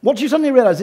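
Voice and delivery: ordinary talk, nothing marked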